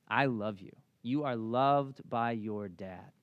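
low, slow, deliberate fatherly assurance; tender reassurance. Retold or imitated. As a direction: let each word sink in gently. Father reassuring his son, earnest.

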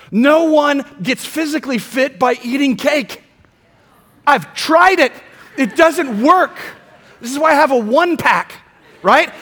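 loud, driving preacher build to the congregation; full-force preaching. Acted. Preacher pressing his congregation, loud and fervent.

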